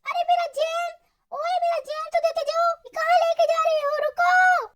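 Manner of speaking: high, shouted call after the thief. Acted. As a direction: yell for the jam back. Calling after someone stealing jam.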